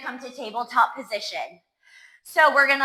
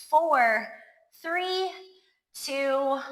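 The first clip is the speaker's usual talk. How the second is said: ordinary talk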